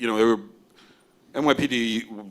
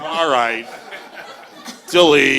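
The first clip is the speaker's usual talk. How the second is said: laughing dismissal; 5 semitones higher, 8 dB louder, slower than usual. Real, not acted. Agent dismissing a bad reel.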